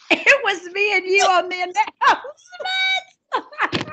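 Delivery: high, loud, helpless laughter through the words; real laughing. Real, not acted. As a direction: crack up while getting the words out. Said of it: "So I laughed because".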